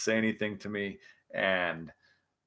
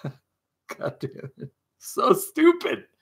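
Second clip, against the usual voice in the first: laughing in exasperation at yourself; high, squeaky laugh through the words; 11 semitones higher, 4 dB louder, much slower than usual. Real, not acted. Laughing at his own rollover video.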